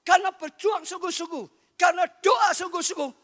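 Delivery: loud, high-pitched revival preaching; shouting sermon from the pulpit; fiery preaching. Acted. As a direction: preach it out at full force. Preacher at full force.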